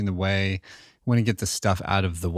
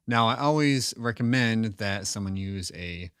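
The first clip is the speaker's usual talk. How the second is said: ordinary talk, nothing marked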